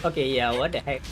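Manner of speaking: ordinary talk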